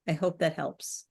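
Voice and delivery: normal talking voice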